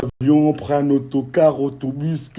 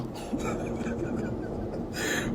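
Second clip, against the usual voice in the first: high airy laugh; 14 dB quieter than usual. Real, not acted. Laughing at the comments.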